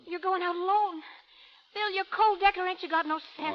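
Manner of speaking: loud, high alarmed protest; alarmed shout. Acted. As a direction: yells at him for going alone. Calamity alarmed, shouting at Bill.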